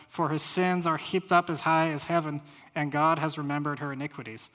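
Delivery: loud, ringing proclamation of scripture; scripture reading. Acted. Preacher reads Revelation nineteen aloud to his congregation, ringing out. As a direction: proclaim it like the roar of heaven's crowd.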